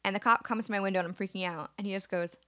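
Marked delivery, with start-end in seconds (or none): none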